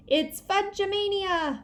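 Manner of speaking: high, slow, drawn-out shriek. A narrator's reading. Mitzi spinning in the yard, high drawn-out shriek. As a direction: shriek it with glee. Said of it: "Mitzi shrieked".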